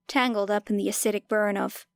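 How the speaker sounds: plain reading voice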